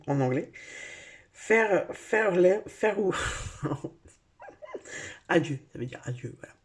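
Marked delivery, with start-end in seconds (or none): 1.3-3.1 fumbling "Faire, faire les, faire ou" as a heavy French-accented try at English farewell; groping for "faire ou" with a strong French accent; French-accented English attempt. Shown as a demonstration. Reader attempting English word in French accent. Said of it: "excuse-moi pour mon accent à chier".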